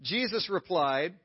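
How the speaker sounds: ordinary talk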